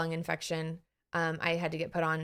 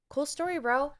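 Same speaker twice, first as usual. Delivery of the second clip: breezy dismissal; 8 semitones higher than usual. Retold or imitated. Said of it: "dismissing social worry".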